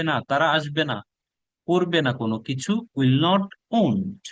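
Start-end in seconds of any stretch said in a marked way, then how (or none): none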